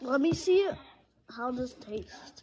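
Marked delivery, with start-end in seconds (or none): 1.3-2.4 whispering. Real, not acted. Tasting candy.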